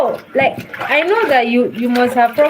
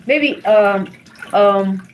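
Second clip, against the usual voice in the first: ordinary talk, nothing marked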